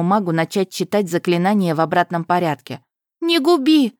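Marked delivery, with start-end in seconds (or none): 3.2-3.9 much higher, louder pleading woman's voice. A narrator's reading. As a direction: beg in a high little woman's voice. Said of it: "заговорил женским голоском".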